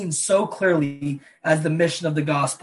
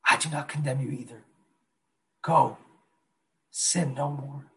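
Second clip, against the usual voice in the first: softer, tender and unhurried; gentle fatherly tone; 4 dB quieter than usual. Retold or imitated. Pastor voicing Jesus forgiving the woman, gentle and soft. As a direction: forgive her softly, like a kind father. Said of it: "with that quiet voice of a father".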